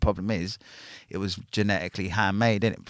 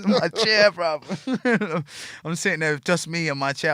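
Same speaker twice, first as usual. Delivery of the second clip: high, louder words bursting through laughter; laughing; 9 semitones higher, 5 dB louder, slower than usual. Real, not acted. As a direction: crack up at the picture of himself. Laughing at his own story.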